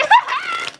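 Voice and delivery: high shrieking burst of laughter; real high laugh at the joke. Real, not acted. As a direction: let out a high squealing laugh. Laughing at mom's words.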